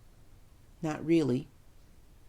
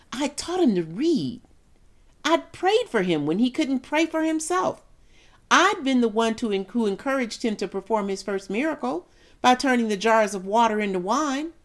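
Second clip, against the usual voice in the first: rising grievance; 5 semitones higher than usual. Acted. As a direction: pitch climbing high as the list builds. Mother listing her sacrifices, building.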